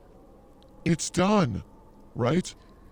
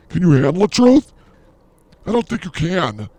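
mock dramatic boom; 5 semitones higher, 10 dB louder than usual. Hyping the show's facts.

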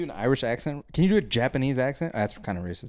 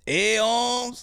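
put-on high, drawn-out South Central Mexican accent; hammed-up South Central Mexican homie, calling out; 10 semitones higher than usual. Showing off an accent for the co-host, South Central Mexican. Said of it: "That was a South Central Mexican".

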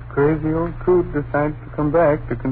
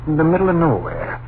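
louder, exasperated telling-off; scolding; 6 semitones higher, 7 dB louder than usual. Acted. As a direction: brush off her silly fear with irritation. Husband scolds wife's ghost idea.

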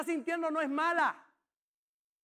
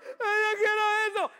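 high, whimpering mock cry; whiny crybaby; 6 semitones higher, 5 dB louder than usual. Preacher mocks adults who cry for things, whimpering and high. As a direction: whine like a grown crybaby. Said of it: "cuarenta años llorones".

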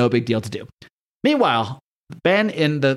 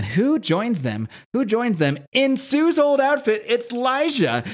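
dramatic reveal; 10 semitones higher, much slower than usual. Real, not acted. Dramatic reveal of surprise guest.

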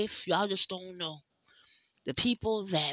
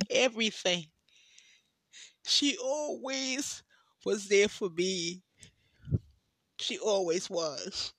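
voice breaking. Real, not acted. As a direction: push the words out through tears. Tearful praise of her friend.

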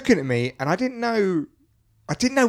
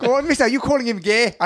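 full-on Glaswegian challenge, pitched up; Glaswegian accent; 6 semitones higher than usual. Pretend. Performs the accent on request for friends, full Glaswegian. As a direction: commit to the Glaswegian, go on mister. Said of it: "I want you to commit to the accent".

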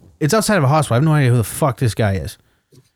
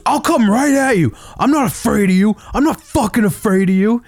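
high-pitched imitation of blustering bravado; mock a guy insisting he's unafraid; mock chirping threat; 9 semitones higher than usual. Imitating the stranger squaring up to him, high mock bluster. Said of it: "He just starts chirping me".

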